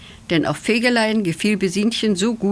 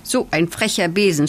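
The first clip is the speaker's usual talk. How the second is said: plain reading voice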